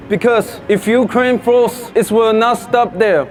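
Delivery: measured speech from the podium; formal speech. Acted. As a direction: read it like an address to a crowd. Delivers a rally speech.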